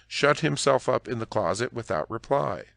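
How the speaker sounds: ordinary delivery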